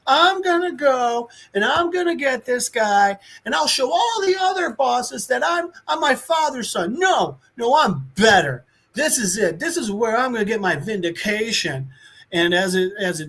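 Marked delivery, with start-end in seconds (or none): 0.1-11.9 high-pitched, self-important mock rant; pontificating kid king. Kid king boasts to his court, high mock pontificating. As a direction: declaim like a boy with something to prove. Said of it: "he's just walking around and he's pontificating".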